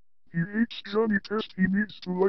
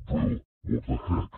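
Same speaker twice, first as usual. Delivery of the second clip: low-pitched voice. Pretend. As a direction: protest in an artificially deep voice. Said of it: "why are you low-pitching his voice".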